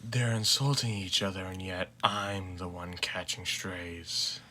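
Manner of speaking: a lightly voiced tired father, flat and unbothered; flat monotone. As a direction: drone it out, no energy. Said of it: "his voice remained flat and monotonous".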